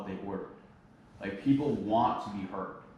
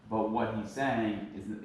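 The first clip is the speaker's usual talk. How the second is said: normal talking voice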